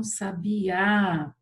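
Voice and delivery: "sabiá" slow, ending on a long strong Á; stress on final Á. Shown as a demonstration. Teacher showing the bird's name, stressed on final Á. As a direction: stretch the last Á of "sabiá". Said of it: "tem que colocar o acento no A".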